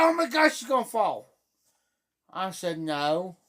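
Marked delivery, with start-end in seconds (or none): 0.0-1.3 fast, high, loud alarmed cry; panicked mother. Retold or imitated. Mother watching the toddler wobble, alarmed and fast. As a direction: gasp it out like she's about to fall. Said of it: "She said".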